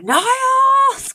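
very high, drawn-out yell of the name; furious scream. Retold or imitated. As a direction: scream for the butler in outrage. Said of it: "And then he screams".

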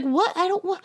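high, bewildered cat voice; confused cat. Retold or imitated. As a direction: play a cat caught out. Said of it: "And Misty was like".

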